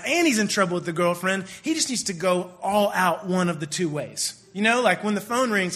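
ordinary talk, nothing marked

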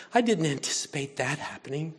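ordinary delivery

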